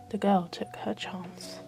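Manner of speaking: ordinary delivery, nothing marked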